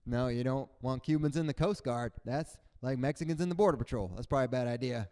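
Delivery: ordinary talk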